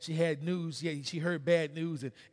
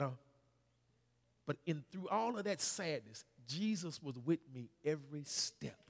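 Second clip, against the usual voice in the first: usual speaking voice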